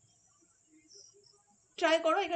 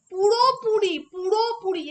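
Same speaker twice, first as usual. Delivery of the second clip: পুরোপুরি hit high and loud, said twice; stress on পুরোপুরি; 4 semitones higher, 8 dB louder, slower than usual. Shown as a demonstration. Teacher to students, stressing পুরোপুরি loudly and high. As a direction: punch পুরোপুরি hard and high. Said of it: "এই শব্দটার উপরে আমি জোর দিতে চাই".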